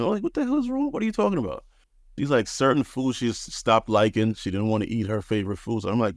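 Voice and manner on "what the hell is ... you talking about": high, startled retelling; startled husband voice, pitched way up; alarmed disbelief. Retold or imitated. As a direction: act baffled and alarmed at her. Retold alarmed reply to wife.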